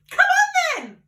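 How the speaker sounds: very high, loud shout. A narrator's reading. Father shouts to George to hurry upstairs, very high and loud. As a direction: bark it out eagerly. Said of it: "cried Mister Killy Cranky".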